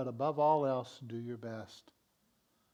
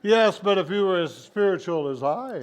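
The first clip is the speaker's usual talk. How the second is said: loud, raised-pitch spiritual show-off; mock boasting; 5 semitones higher, 13 dB louder than usual. Playing someone more spiritual than everyone, loud mock boasting. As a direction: protest loudly with hammed-up superiority.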